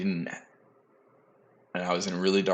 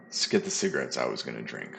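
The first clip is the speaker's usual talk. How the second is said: repeated much lower, as a wry self-correction; deadpan repeat; 8 semitones lower, 3 dB quieter, faster than usual. Real, not acted. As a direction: drop the pitch and land the joke dryly. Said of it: "Come on now".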